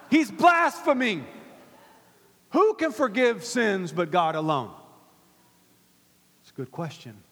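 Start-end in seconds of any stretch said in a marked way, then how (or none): 0.1-4.7 loud accusing outburst in a sermon; mock-scandalized preacher's quote; acted indignation. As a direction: play the outraged objector, loudly. Voices the objecting teachers of the law.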